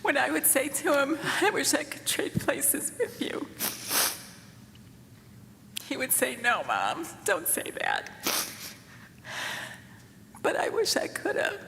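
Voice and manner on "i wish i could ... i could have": tearful. Real, not acted. Grieving mother at eulogy.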